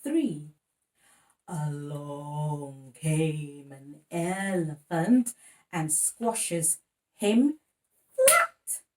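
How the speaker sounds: slow, loud, playful rhyme voice; rhyme for baby. Acted. Parent reciting a rhyme to baby, playful and drawn out.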